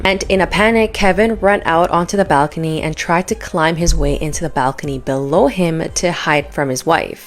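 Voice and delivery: punchy gossip show voiceover; tabloid host. Acted. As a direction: hype up the scandal like a tabloid host. Sensational gossip story read.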